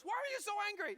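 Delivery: high, loud exasperated question; wife's challenge. Acted. Wife turns to shouting husband in the car, loud challenge. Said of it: "your wife turned to you and goes".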